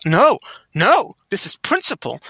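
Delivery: high-pitched, worked-up protest; acted outrage on principle; indignant insistence. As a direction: dig in stubbornly, pitch leaping up. Acted stubborn argument with himself.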